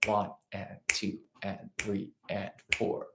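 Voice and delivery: slow, soft count like a shaker; shaker subdivision count. Retold or imitated. Teacher imitating a percussionist's shaker, slow and soft. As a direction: tick off the subdivisions evenly. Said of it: "they'd be like".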